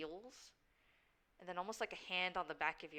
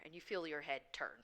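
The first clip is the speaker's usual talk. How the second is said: normal talking voice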